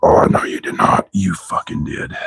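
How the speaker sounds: whispered "Oh, no, you did not", no voice. Real, not acted. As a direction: breathe it out as a stunned whisper. Whispered repeat of the same line.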